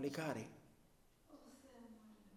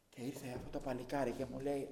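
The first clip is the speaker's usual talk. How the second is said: normal talking voice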